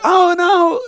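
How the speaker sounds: high, loud mock "oh no"; polite reassurance. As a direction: imitate overly polite protesting. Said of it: "they did the polite".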